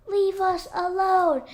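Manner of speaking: high frightened weasel squeak. A narrator's reading. Weasel crouched in the grass, squeaking at the robot. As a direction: squeak it out, cowering. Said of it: "squeaked Dart".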